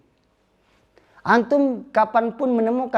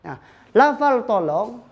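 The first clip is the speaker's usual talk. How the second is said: usual speaking voice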